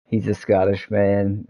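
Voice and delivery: ordinary talk, nothing marked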